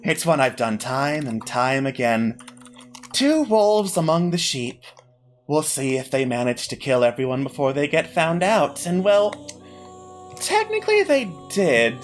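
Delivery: smug, patronising villain. Acted. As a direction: purr it out like a gloating host. GM voices villain hostess.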